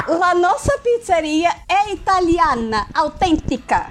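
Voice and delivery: hammed-up Italian accent; mock Italian reading a job ad; Italian accent portuguese. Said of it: "fazer o sotaque italiano".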